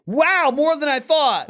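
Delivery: high fake amazement; mock surprise. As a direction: play pleasantly surprised. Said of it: "I set it up to go".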